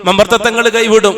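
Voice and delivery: ordinary delivery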